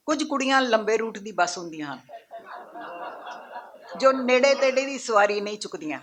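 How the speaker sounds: lifted opening, falling line ends; poem recitation. Acted. Poet reciting her poem about girls to an audience, declaimed recitation. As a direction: declaim each line to the room, then pause.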